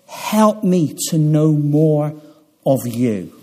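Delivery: low, hushed prayer voice, unhurried. Acted. Preacher voicing a child's prayer, hushed and low. Prays on behalf of listeners.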